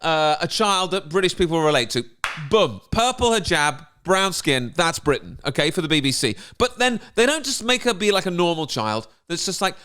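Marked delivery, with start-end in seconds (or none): none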